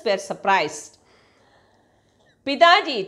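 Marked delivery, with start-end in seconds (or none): 2.5-3.0 a higher, louder call of Pitaji; loud clear call. A narrator's reading. Daughter calls her father to refuse the dowry, loud and high. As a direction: make Pitaji ring out across the room, higher and louder. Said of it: "in a clear, loud voice".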